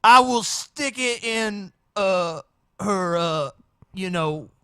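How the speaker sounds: high, loud, drawn-out impersonation; hammed-up mock convert, halting and high-pitched; parody testimony voice. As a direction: mimic the testifying man, stretching the words. Parodying the clip's testifying man.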